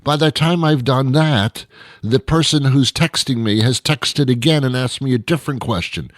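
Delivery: normal talking voice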